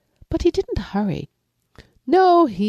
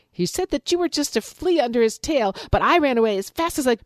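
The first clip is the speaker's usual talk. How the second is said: much higher, fast gossipy rabbit; chattering rabbit; 7 semitones higher, faster than usual. Acted. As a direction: rattle off the tale to stir him up. Rabbit tells his lie.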